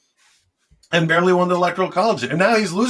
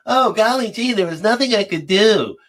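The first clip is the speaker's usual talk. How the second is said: high, prissy mock helpless voice; a fake-innocent weakling shrugging off the fight; mock wimp; 6 semitones higher than usual. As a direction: whine it like a pushover who gave up. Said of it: "I don't sit around and go".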